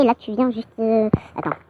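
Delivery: ordinary talk, nothing marked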